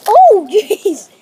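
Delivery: startled shriek; sudden high yelp of fright; scream into giggles. Real, not acted. Car crash startles the kids.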